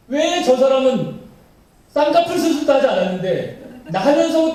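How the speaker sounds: high-pitched, building jealous whine to God; envious complaint. Acted. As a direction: play up the indignant "why them, not me?". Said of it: "영적으로 시기해요". (시기에 찬 높은 목소리의 하소연)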